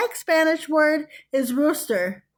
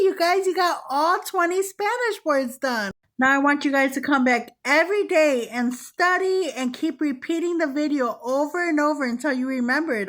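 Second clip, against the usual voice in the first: ordinary talk, nothing marked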